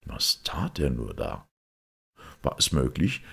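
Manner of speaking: ordinary delivery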